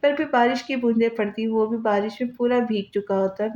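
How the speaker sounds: usual speaking voice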